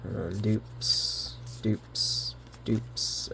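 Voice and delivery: whispering under breath; whispered mutter. Real, not acted. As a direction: mutter it to yourself. Muttering over duplicate cards.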